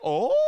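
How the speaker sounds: mock-outraged rising howl; hammed-up mom wail; long high "ohhh". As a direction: hold a very high, loud "oh". Mad mom reacts to the answer.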